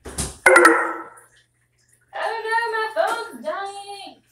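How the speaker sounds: high, drawn-out wail; dismayed wail. Real, not acted. Streamer fretting over a dying phone, dismayed wail. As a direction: stretch it out high and plaintive.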